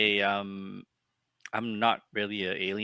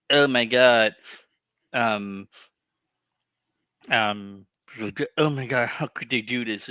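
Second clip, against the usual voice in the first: mock outrage. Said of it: "acting like".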